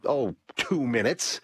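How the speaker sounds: usual speaking voice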